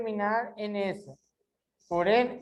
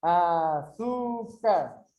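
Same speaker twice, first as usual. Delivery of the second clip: "açúcar" very slow, syllables drawn apart; slow teaching split of "açúcar"; 3 semitones lower, much slower than usual. Shown as a demonstration. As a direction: stretch out each syllable of "açúcar". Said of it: "Onde tá mais forte?".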